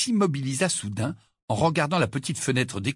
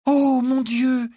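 high, drawn-out gasp of astonishment; slow, stunned outcry; breathless exclamation; 12 semitones higher, 4 dB louder, much slower than usual. A narrator's reading. Hermione recognises her former professor, breathless with surprise. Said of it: "la voix haletante".

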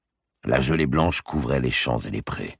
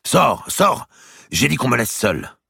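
very high, loud angry shout; violent outburst; 11 semitones higher, 6 dB louder than usual. Acted. The king furiously throwing out his chamberlain, shouting. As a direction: drive the servant out of the room. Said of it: "traité avec pareille violence".

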